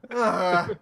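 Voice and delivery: usual speaking voice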